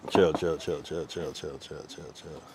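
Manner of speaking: low repeated plea, fading to very soft; fading calm-down. Real, not acted. Repeatedly telling someone to calm down.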